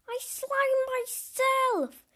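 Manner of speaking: high, slow horrified wail. A narrator's reading. Teen wailing after snot lands on his face. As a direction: wail it out in disgust. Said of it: "he wailed, horrified".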